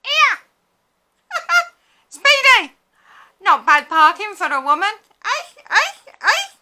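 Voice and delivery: high, loud laughing heckler; shouted bus heckle. Retold or imitated. Man at the back of the bus heckling her, loud laughing shout. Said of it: "will shout from the back".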